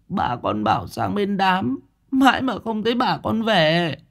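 put-on high, plaintive child voice; tearful little boy. A narrator's reading. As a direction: complain like an upset child left waiting. Tearful boy explains grandma is away.

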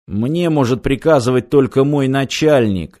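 plain reading voice

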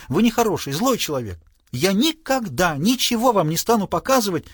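high, weepy reproach; hammed-up wounded outburst; tearful cry. A narrator's reading. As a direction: scold him as if between sobs. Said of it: "вскричала Сесиль между рыданиями".

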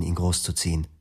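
ordinary delivery

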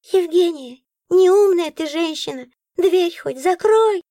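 high, slow scolding; scolding creature. A narrator's reading. Nora scolding the heroine, high slow. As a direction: tell her off like a fool. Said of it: "высказалась Нора".

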